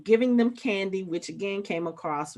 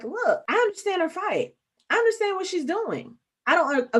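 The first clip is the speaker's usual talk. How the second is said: higher-pitched, insistent defense; earnest pleading; 10 semitones higher than usual. Retold or imitated. Sam defending Carly to Bucky, earnest pleading. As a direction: make the case for her, pitched up.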